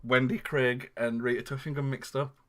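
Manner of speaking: usual speaking voice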